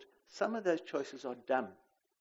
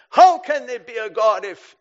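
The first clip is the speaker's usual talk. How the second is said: loud, high, defiant demand at God; defiant challenge; 9 semitones higher, 22 dB louder than usual. Shown as a demonstration. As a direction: hurl the question like an accusation. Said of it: "your tone of voice when you ask a question is critical".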